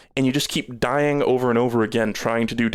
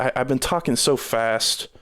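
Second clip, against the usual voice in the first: normal talking voice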